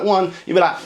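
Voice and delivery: ordinary talk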